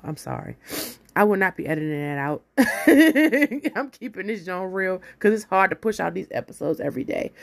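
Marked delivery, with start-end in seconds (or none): 0.0-0.6 whispered apology; whispering. Real, not acted. Apology after the sneeze. 2.5-3.7 loud laugh. Real, not acted. Laughs at the sneeze.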